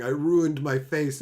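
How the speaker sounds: ordinary delivery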